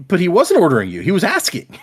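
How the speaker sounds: exasperated correcting comeback; indignant protest. Retold or imitated. Arguing back at the Stormfather.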